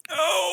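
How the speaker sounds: high-pitched, drawn-out cry of "no"; mock-despairing wail.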